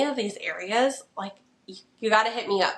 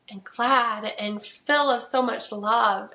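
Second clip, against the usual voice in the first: usual speaking voice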